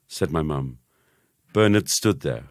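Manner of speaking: ordinary delivery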